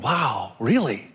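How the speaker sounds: ordinary talk, nothing marked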